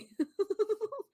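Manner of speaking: high helpless laugh of disbelief; despairing laugh. Real, not acted. Commentator laughing helplessly at the news. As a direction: laugh out the hopelessness.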